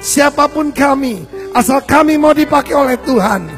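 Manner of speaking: ordinary delivery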